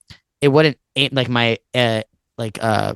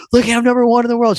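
higher, louder, fast excited bragging; excited boast; 11 semitones higher, 4 dB louder, faster than usual. Retold or imitated. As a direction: rush it out, pointing at the screen. Showing mom his world ranking.

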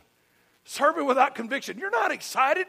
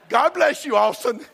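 mock church ladies; 7 dB louder than usual. Church ladies greet the boy back from his whipping, mock blessing.

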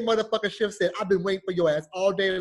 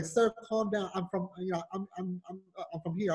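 much softer, hesitant caller's voice; timid collector; 2 semitones lower, 8 dB quieter than usual. Retold or imitated. Bill collector trying to calm the caller, timid and quiet. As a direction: back down nervously, stumbling.

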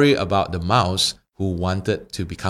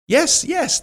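very high, eager little mouse; eager squeaky yes. Acted. Mouse eagerly accepts the wish.

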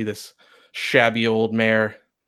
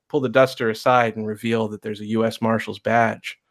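ordinary delivery

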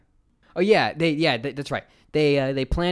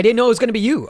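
defensive; 10 semitones higher, 10 dB louder, much faster than usual. Retold or imitated. Brother defends himself mid fight.

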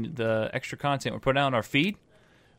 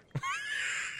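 high laugh; 8 dB quieter than usual. Real, not acted. Laughs at the naked prophet joke.

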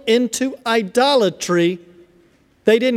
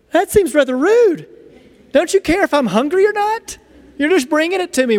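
higher-pitched offended protest; mock indignation; 5 semitones higher than usual. Guest handed food without being asked, mock indignant. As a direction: play the affronted guest, pitched up. His culture's offended reaction.

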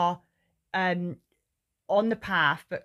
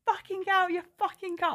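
much higher, furious retold yell; fuming demand; 12 semitones higher than usual. Furious mother demands the driver gets out, high and angry. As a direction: let the rage spike up high. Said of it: "Oh, I was fuming".

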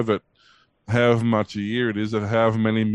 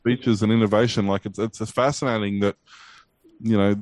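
ordinary talk

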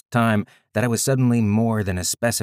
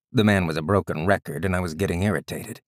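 usual speaking voice

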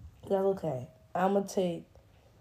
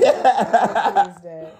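laughing; 9 dB louder, slower than usual. Real, not acted. Taunting while laughing.

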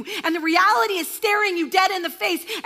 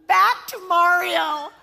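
slow, louder clinic call; calling a name; 5 dB louder, slower than usual. Retold or imitated. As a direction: call out slowly across the waiting room. Vet staff calling the owner.

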